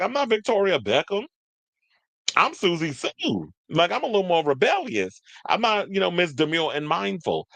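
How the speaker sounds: high, mock-sassy rebel voice. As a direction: toss it off like a defiant star. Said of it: "And she's like".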